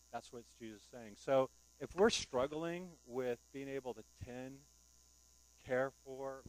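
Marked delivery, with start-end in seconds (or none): none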